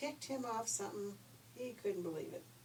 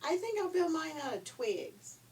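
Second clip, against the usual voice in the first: little pig; 9 semitones higher, 7 dB louder than usual. A narrator's reading. Second pig voiced in story.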